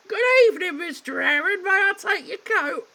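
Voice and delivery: high, loud falsetto maid. Acted. Maid greeting the heir at the door, falsetto. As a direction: squeak out a prim maid. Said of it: "Couldn't resist".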